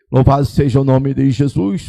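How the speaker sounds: ordinary talk, nothing marked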